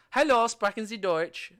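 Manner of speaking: bright-pitched polite German inquiry; formal German phrase. Shown as a demonstration. Tourist asking a stranger in Germany, formal German greeting. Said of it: "you know, German".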